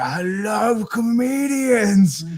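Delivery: high, drawn-out gush of excitement; hammed-up old man, thrilled, stretching it out slowly; mock delight. Creepy old man lights up hearing she's a comedian, gushing. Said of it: "his fucking eyes light up".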